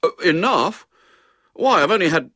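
loud, high schoolboy protest; outraged small boy, breaking off; indignant protest. A narrator's reading. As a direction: object as if unjustly accused. Boy protests to his mother.

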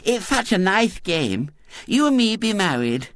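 put-on very high eager little girl; eager small-girl suggestion; Violet Elizabeth, eagerly. A narrator's reading. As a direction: pipe up keenly with a childish lisp. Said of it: "said Violet Elizabeth eagerly".

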